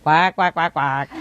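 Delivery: imitated duck quacks; duck quacking. Parrot imitates ducks exactly, quacking. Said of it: "if she say like a duck".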